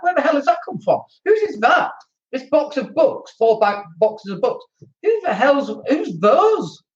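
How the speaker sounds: high-pitched, incredulous questioning; exasperated bafflement. Retold or imitated. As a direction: demand answers about mystery clutter, half laughing. Acted exasperated landlord spotting clutter.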